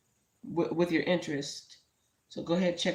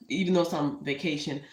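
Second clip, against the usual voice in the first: normal talking voice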